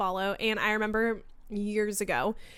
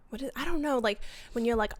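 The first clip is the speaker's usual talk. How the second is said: ordinary delivery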